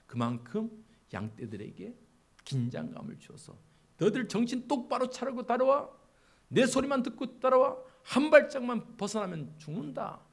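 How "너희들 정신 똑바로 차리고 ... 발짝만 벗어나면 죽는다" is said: high, loud stern call; a herder shouting firm commands; shepherd's shout. Acted. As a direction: order the flock to follow your voice. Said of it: "이런 긴장감을 주면서 소리를 막 치는 거예요".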